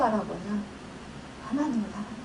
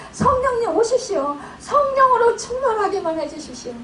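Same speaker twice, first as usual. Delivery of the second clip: tearful prayer; 8 semitones higher than usual. Retold or imitated. Pastor reenacting her prayer to God, tearful high plea. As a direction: call on the Spirit with tears in the voice. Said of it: "이렇게 고백을 하는 거예요".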